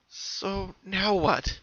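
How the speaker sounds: pony wonders what to do next, uncertain; wondering aloud. A narrator's reading. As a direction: ask it into the dark. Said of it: "she asked herself".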